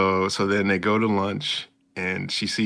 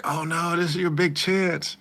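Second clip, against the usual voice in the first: mock worry; 10 semitones higher than usual. Own worried reaction retold.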